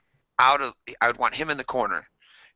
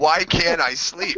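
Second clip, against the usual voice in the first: slow, laughing complaint; laughing frustration; 8 semitones higher, 4 dB louder, much slower than usual. Retold or imitated. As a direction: whine it slowly through laughs. Acted sleepless self-talk.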